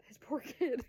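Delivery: laughing mock dismay; amused mock pity. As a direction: say it aloud with a chuckle after. Repeats whispered thought aloud, laughing.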